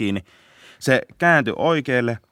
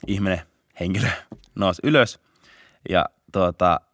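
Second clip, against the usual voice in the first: usual speaking voice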